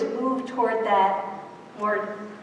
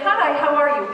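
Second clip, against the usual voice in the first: quick, lightly raised passing greeting; just being polite; 3 semitones higher, 4 dB louder than usual. Acted. New Yorker to colleague before moving on, perfunctory politeness. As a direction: say it in passing, as mere courtesy. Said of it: "We're just being polite".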